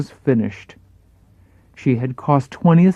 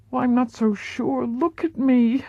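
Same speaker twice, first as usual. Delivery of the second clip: higher, worried starlet voice; anxious Marilyn; 8 semitones higher, 3 dB quieter than usual. Acted. Marilyn to her acting coach, higher and fretful. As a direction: voice her fear of aging, pitched up. Marilyn doubts herself to Paula.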